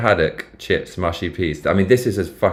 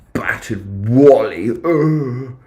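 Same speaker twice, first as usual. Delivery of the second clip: mock posh British; 4 semitones higher, much slower than usual. Savouring the British dish name.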